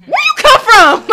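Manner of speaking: high, loud, drawn-out question; astonished. Retold or imitated. Woman retelling her best friend popping up, astonished. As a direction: squeal it in comic bewilderment. Startled by friend suddenly appearing.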